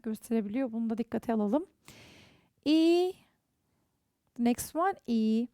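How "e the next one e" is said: ordinary talk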